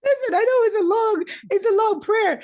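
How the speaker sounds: loud, high and laughing; real laughter pushing the words up high and loud; laughing protest. Real, not acted. Laughing about own long prayer.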